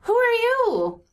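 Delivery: put-on high, slow little kid voice; child voice. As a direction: play a curious small child. GM voicing child NPC.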